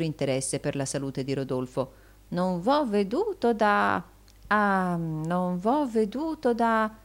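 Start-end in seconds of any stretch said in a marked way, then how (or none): 2.3-6.9 slow, drawn-out, halting words; slow old flatterer feigning interest; lightly voiced fawning usurer. A narrator's reading. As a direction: stretch it out, raised in pitch. Gride feigns concern, halting over words.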